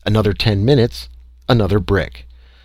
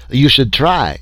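put-on loud, higher, French-accented outburst; exclaiming; 5 semitones higher, 4 dB louder than usual. A narrator's reading. Little Frenchman urging an experiment, exclaiming. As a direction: urge it eagerly with raised voice. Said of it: "exclaimed the little Frenchman".

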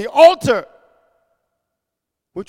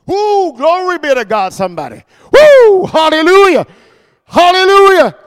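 high loud hallelujah shouts; preacher shouting praise; joyful shout; 5 semitones higher, 6 dB louder than usual. Real, not acted. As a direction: erupt in praise. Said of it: "praise exclamation".

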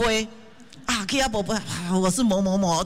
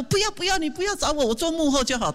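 much higher, whiny "no, no" of a shy volunteer; mock refusal; 8 semitones higher than usual. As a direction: push the offer away in a hammed-up high voice. Imitating volunteers refusing a role.

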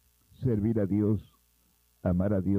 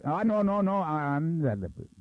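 raised voice; 7 semitones higher than usual. Retold or imitated. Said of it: "Y siguió vociferando".

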